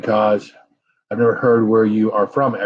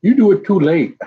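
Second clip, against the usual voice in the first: high, louder mock of an older coworker; coworker's complaint; 8 semitones higher, 5 dB louder than usual. Coworker Wally teasing the streamer for going live too late. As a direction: chide him for starting late. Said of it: "He's like".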